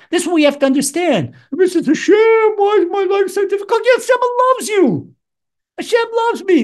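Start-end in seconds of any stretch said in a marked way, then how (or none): 1.5-3.8 high, wailing mock complaint; mock whining plea. Mimics a complainer pleading with God. 3.8-6.6 high, impassioned insistence; emphatic reassurance. Real, not acted. Emphatic answer to the mock complaint.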